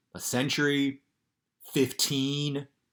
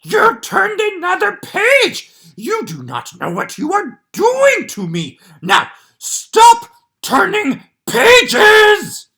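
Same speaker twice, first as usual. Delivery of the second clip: high, loud, slow furry monster shouting; a panicked puppet monster begging the reader; Grover voice; 17 dB louder, slower than usual. Acted. Grover voice reading the book.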